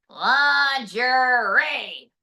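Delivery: very slow "Lingerie" stretched out; drawn-out punchline. Acted. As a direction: savor the punchline word.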